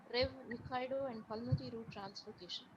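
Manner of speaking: very faint, far from the mic; barely audible. Real, not acted. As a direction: voice almost gone, barely there. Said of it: "your voice is very, uh, very faint".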